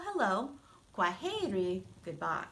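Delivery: normal talking voice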